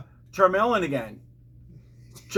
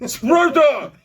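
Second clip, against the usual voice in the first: loud, hammed-up Russian accent; 9 semitones higher, 7 dB louder than usual. Shown as a demonstration. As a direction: shout it in a mock Russian accent. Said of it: "Russian accent".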